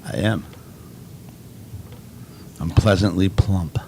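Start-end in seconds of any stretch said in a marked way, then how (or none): none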